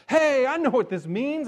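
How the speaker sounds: high, loud mock discovery; mock eureka. Preacher mocking interpreters' eager discovery. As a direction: toss it off like a know-it-all. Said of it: "people looking at the passage and going".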